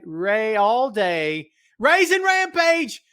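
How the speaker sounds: drawn-out, high, loud ring-announcer nickname; wrestling announcer call. Pretend. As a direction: belt his nickname out like a ring introduction. Greeting viewer with ring-style nickname.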